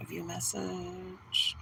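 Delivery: slow whisper to herself; slow whispered reading of the screen; whispered aside. Real, not acted. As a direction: read it under her breath, slowly.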